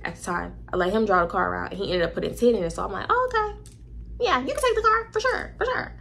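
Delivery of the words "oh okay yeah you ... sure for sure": high, mock-sweet agreement; sarcastic fake okay. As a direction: sing it up high like you are pretending to be fine. Mocking her own fake agreement.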